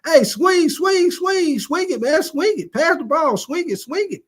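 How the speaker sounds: high, urgent calls to swing the ball; Jimmy Butler yelling on court. Retold or imitated. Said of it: "And he gonna start saying".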